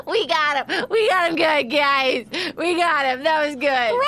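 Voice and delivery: talking through giggles; laughing. Real, not acted. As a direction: gloat while laughing. Laughing after successful prank.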